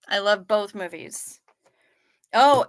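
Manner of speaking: ordinary delivery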